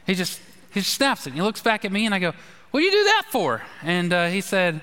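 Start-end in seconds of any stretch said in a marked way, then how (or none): 2.7-3.6 high, incredulous retold question; pitched-up mock disbelief; mock exasperation. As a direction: throw up your hands at a clumsy friend.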